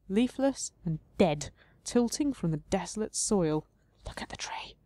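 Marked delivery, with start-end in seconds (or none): 4.1-4.8 breathy whisper to the game; hushed aside to the game. Real, not acted. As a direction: whisper the command.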